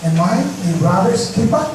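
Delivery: lightly voiced insolent brother's retort; a hint of sarcastic defiance; rude retort. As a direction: toss it back at God with a shrug. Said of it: "Ganito ito magkakasabi".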